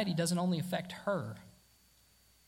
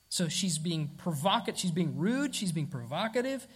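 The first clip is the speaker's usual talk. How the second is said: ordinary talk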